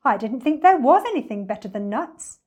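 perky storybook monkey; puzzled monkey. Acted. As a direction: protest in innocent surprise.

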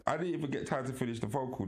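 ordinary delivery

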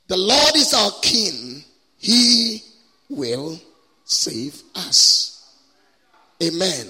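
deliberate, emphatic phrases with long pauses; scripture proclamation. Acted. Preacher reads verse for congregation to recite, emphatic proclamation. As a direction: proclaim each line for a crowd to repeat.

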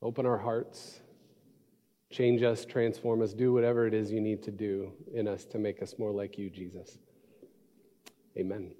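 hushed, earnest closing prayer. Real, not acted. Pastor praying over the congregation, soft and earnest. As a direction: pray it softly and sincerely.

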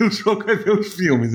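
chuckling through the words; laughing. Real, not acted. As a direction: laugh as you say it. Joking reassurance.

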